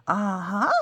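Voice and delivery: loud bright exclamation; triumphant aha. Acted. The cookie acting out solving a puzzle with a loud aha. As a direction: cry it out like you cracked it.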